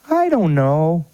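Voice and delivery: put-on high childlike boy voice; little boy voice. Grandson answering grandpa, in a high little boy voice. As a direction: pitch up into a young boy.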